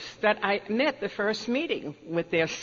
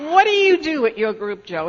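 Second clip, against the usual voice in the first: higher and louder, arrogant mock question; smug, arrogant; 6 semitones higher, 6 dB louder than usual. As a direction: lord it over him, fully smug. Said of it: "arrogant attitude".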